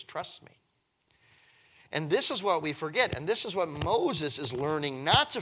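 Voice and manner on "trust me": soft reassurance. Retold or imitated. God reassuring Moses.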